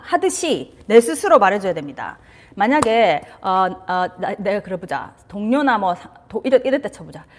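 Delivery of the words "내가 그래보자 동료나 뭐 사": ordinary talk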